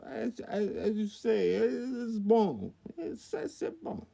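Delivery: very high, halting French caricature; mock French accent. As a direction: fumble for words as a squeaky Frenchman. Mock Parisian with French words.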